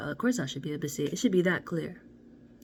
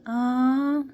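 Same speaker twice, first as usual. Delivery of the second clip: delighted aww; 7 semitones higher, 10 dB louder, much slower than usual. Real, not acted. Moved by the card spread.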